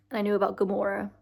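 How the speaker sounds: ordinary talk, nothing marked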